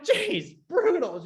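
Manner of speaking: high squeaky mock gasp of shock. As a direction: yelp it like you've been stung. His shocked reply.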